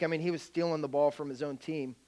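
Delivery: ordinary delivery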